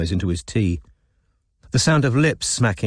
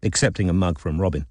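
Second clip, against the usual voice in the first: ordinary delivery, nothing marked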